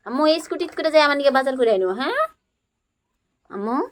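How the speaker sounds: put-on high, bright play voice for a small child; playful toddler-talk. Mother playing house with her toddler, high coaxing voice. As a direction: coax the little one along in pretend play. Pretend scooter shopping trip.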